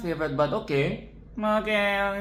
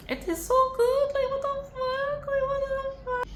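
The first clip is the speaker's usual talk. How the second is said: very high squealing delight; giddy high-pitched disbelief; excited squeal. Real, not acted. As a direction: shriek it out in pure glee. Squealing excitement about film.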